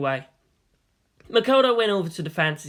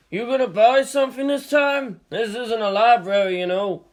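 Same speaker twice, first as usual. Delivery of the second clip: put-on higher, louder gruff needling from an old man; teasing shop owner; 6 semitones higher, 5 dB louder than usual. A narrator's reading. Old shop owner teases his customer about never buying, higher and louder. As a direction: needle him like a grumpy shopkeeper.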